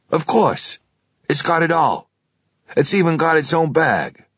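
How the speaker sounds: a keen clerk talking up his stock; pawnshop salesman. Acted.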